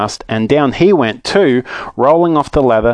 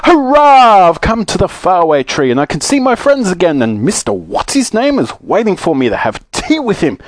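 high, jubilant holler, easing after the hurrah; delighted shouting; 8 semitones higher, 3 dB louder than usual. Acted. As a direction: burst out with a loud high cheer, then keep it elated. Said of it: "shouting in delight".

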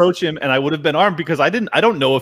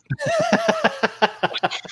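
a high airless burst of laughter; high breathy laugh; 4 dB quieter than usual. Real, not acted. Friend laughs at the neighbor who shot into the apartment, breathy high laugh. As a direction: laugh in disbelief at the shooting story.

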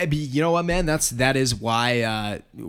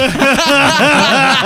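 big high laugh bursting out; loud burst of laughter; 16 dB louder than usual. Real, not acted. Laughing at his buddy's retold words, a loud burst of laughter. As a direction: crack up hard at the quote.

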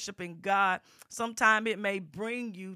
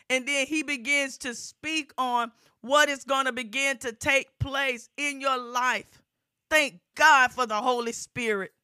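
rising, louder gospel preaching, driving each phrase; full-force preaching; 5 semitones higher, 7 dB louder than usual. Acted. Preacher urging the congregation, full-force preaching. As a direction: preach it out to the whole congregation, building.

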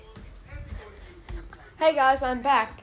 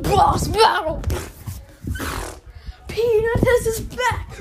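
high, loud boss announcement; hype announcer; 9 semitones higher, 3 dB louder than usual. Acted.

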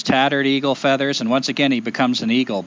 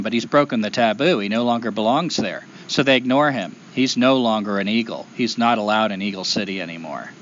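plain reading voice

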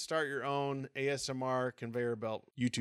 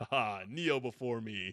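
dark lord; 2 semitones lower than usual. Pretend. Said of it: "he's like General Zod".

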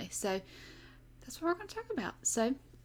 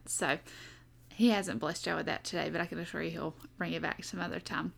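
ordinary talk, nothing marked

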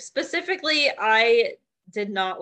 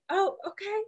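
much higher, squeaky, mock unsure; mock awkward family; 11 semitones higher, 4 dB quieter than usual. Co-host imitates family reacting to the piano recital news. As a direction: play the family's baffled politeness. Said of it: "his family's like".

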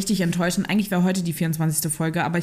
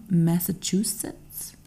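put-on Massachusetts in an American accent; 2 semitones lower, much slower than usual. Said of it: "Soll ich's mit einem amerikanischen Akzent?".

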